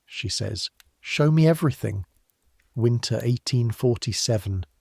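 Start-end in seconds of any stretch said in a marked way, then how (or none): 1.0-2.1 eager. A narrator's reading. Eager girl arriving in Boston.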